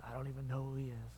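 hushed, heavy, regretful; hushed final denial. Retold or imitated. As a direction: let the denial die away. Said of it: "the last words that Peter said".

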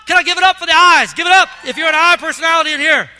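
loud, high rallying call; preaching at full force. Acted. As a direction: whip the congregation into applause. Rallying the congregation.

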